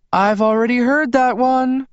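high, loud call; calling after someone. A narrator's reading. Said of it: "Rosalie called after me".

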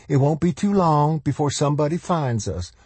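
high-voiced, insistent mother; interrupting. A narrator's reading. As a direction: press on in a high voice, refusing doubt. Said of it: "Darlene interrupted".

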